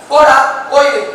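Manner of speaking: loud pushing away; angry dismissal. Acted. Kumbhakarna driving his weeping brother away angrily. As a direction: send him off sharply. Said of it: "அழுதவன் அப்படி எடுத்த".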